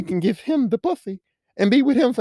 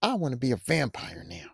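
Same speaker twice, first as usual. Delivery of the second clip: soft mock Bella; 6 semitones lower, 9 dB quieter than usual. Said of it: "Bella's like".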